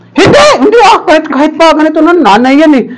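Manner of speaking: high, quick insistent denial; acted protest. Retold chat defending someone's mother, mock protesting. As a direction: jump in high, objecting.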